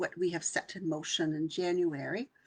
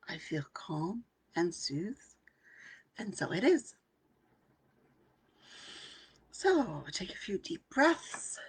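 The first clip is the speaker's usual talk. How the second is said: guided meditation affirmations. Acted. Coach leading her workshop group in meditation, hushed.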